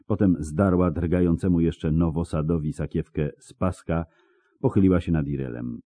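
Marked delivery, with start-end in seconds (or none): none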